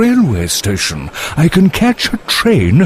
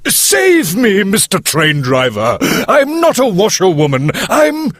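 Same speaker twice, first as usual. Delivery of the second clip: high-pitched hammed-up begging; frantic pleading; 14 semitones higher than usual. Toad begs the driver to save him, high frantic pleading. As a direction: confess desperately in a very high voice.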